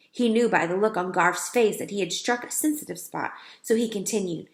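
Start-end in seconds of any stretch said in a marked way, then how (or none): none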